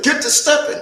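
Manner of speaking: high-pitched, brusque dismissal; dismissive order. Acted. Recruiter orders him out the door.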